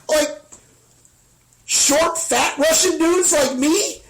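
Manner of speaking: high, slow, disbelieving question; incredulous squeak. Retold or imitated. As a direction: ask it as if you can't believe your ears. Incredulous at the dealer.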